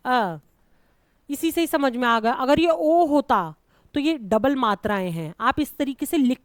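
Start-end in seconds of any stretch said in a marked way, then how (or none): 0.1-0.4 a lone, brief "uh" for अ; short vowel. Shown as a demonstration. Teacher isolating the small vowel अ, said short. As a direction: say अ short, on its own. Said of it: "छोटा वाला आ है".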